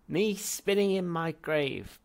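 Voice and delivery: slow, high, very loud bark. Acted. Ancestor barking at his great-grandson, slow and loud. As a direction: bark it at him. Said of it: "barked the ancestor".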